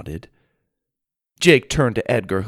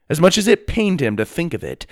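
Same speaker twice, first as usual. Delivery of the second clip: plain reading voice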